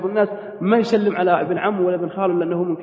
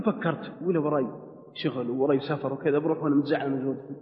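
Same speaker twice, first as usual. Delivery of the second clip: brooding aside; 4 semitones lower, 11 dB quieter than usual. Retold or imitated. Husband's inner thoughts, brooding.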